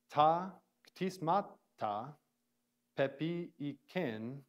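ta ktismata said slowly, syllable by syllable; slow sounding out. Shown as a demonstration. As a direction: spell out ta ktismata slowly for students. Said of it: "let's go through kind of quickly".

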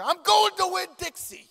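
loud, high, laughing insistence; high laughing declaration of going to Winn-Dixie; insistent. Retold or imitated. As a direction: plant your feet and announce it, half laughing. Insisting to his wife.

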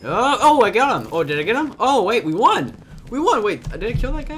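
high, loud surprised joy; high, loud gamer excitement; excited cheering. Real, not acted. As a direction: celebrate the win out loud. Thinks he won the round.

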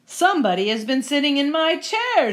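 loud, indignant big-bear outburst; Papa Bear. Acted. Papa Bear finding his porridge disturbed, loud indignant voice. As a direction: project it firmly, rising on the complaint. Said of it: "said Papa Bear".

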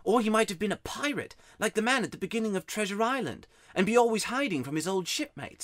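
put-on high-pitched boy's storytelling; boy confiding a mystery. A narrator's reading. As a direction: tell the family secret as a young boy. Narrator voices Digory.

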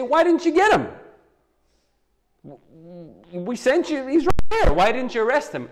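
an incredulous boss scolding his men; indignant scolding. Retold or imitated.

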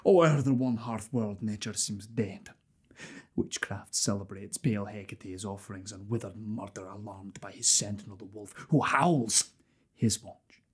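low, soft, brooding verse; dark hushed soliloquy. Acted. Murderer alone thinking of witchcraft and the wolf, hushed soliloquy. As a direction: sink into a hushed, ominous murmur.

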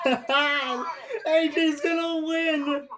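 laughing with joy. Real, not acted. Driver wins, laughing in joy.

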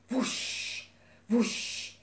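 airy, whispered wing whoosh; wing whooshes. A narrator's reading. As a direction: breathe out two separate wingbeats. Narrator imitates beating wings.

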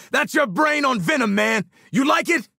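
high, exultant shouted taunt; gloating taunt. Acted. Mocking an enemy dosed with Venom, gloating shout. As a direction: taunt the victim, relishing it.

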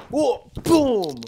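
high, loud yelp into a booming impact; acted-out crash. Skater re-enacts his fall onto the sidewalk, loud high crash. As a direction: cry out, then boom the hit.

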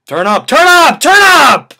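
loud, high hype chant building; hammy stage actor. Acted.

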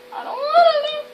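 high panicked whine; scared whine. Real, not acted. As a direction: whine in fright.

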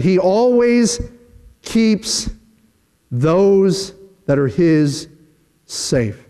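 preacher slows and sinks to drive it home; slow emphatic fade. Acted. Preacher to congregation, slow fading emphasis. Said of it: "preacher's slow emphatic build".

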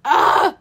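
loud exasperated ugh; groaning loudly in frustration; loud frustrated groan. Real, not acted. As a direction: let out a loud frustrated grunt. Frustrated at mistake.

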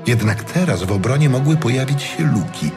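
ordinary delivery

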